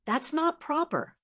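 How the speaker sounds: high, stern rebuke; reprimanding. A narrator's reading. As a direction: scold sharply and high. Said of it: "He frowned and reprimanded".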